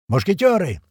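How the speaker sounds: high-pitched hail to comrades; yelled call. A narrator's reading. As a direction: holler for your squad. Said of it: "орёт Слива".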